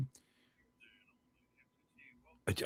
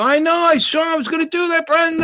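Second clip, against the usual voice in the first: excited; 6 dB louder than usual. Real, not acted. Excited, sees Brenda arrived.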